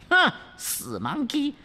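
high, contemptuous hmph before 死monkey; high demoness spitting 死monkey; scornful princess. Acted. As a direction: sneer 死monkey at him. Princess scoffs.

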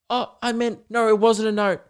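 flustered teacher. Retold or imitated. Teacher backpedalling.

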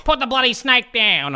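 high, rough ocker bark; gruff Aussie bark. Retold or imitated. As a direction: bark it like an old outback poppy. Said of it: "pops up out of some sand and goes".

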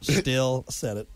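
drawn-out, quiet insistence; slow, emphatic repeat. Real, not acted. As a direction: spell it out slowly to close the argument. Repeats retort slowly for emphasis.

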